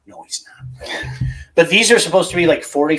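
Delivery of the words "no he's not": soft unvoiced whisper; whispered aside. Real, not acted. Host whispering a joke about the producer to listeners, whispered.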